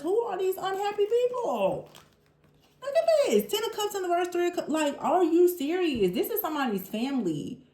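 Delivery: can't believe what she sees; shocked disbelief. Real, not acted. Shocked by the tarot cards.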